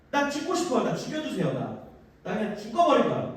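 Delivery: softly grumbling to God; mock self-pitying sulk; quiet, petulant moan; sulky complaint. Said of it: "하나님한테 투정을 부립니다".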